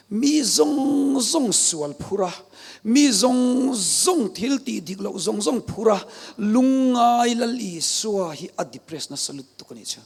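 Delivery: drawn out, high and loud, each phrase weighed; solemn preaching. Real, not acted. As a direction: lay the weight of the world's sin on every word. Slow solemn preaching on Christ. (thu sawi muang tak leh ri ring taka)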